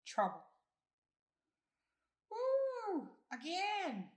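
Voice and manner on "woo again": high-pitched whooping cheer; excited yell. A narrator's reading. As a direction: whoop loudly and call for more. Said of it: "I yelled".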